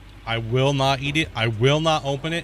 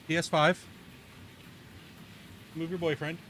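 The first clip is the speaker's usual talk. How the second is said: soft, higher coaxing; coaxing the cat; 5 semitones higher, 11 dB quieter than usual. Real, not acted. Calling Fluffington up to him, soft high coaxing. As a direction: gently lure the kitty up.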